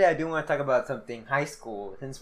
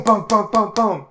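imitated pounding; 5 semitones higher, 7 dB louder, slower than usual.

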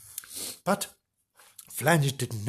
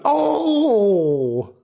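long, high, loud wail of dismay; hammed-up dismayed "Oh"; shout of dismay; 6 semitones higher, 5 dB louder than usual. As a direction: let out a drawn-out horrified cry. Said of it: "Just like that".

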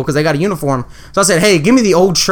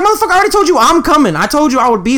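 retold angry yell; 9 semitones higher, faster than usual. Yelling at his brother over the phone, exasperated. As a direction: snap at him like he's wasting your time.

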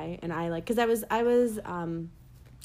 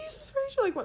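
much higher mock mother; exasperated mom. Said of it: "She was like".

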